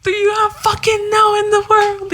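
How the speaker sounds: high, ranting imitation; hammed-up indignant cry; imitated outburst. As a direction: rant it like an exasperated man. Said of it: "He was like this".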